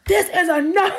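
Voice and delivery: high-pitched retold outburst, half laughing; hammed-up exasperation; going off. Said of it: "went off".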